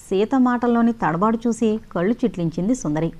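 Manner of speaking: ordinary delivery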